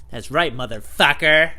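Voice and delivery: hammed-up victorious taunt; cocky retort. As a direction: draw it out, punch the curse. Triumphant retort after payment.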